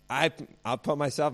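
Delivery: ordinary talk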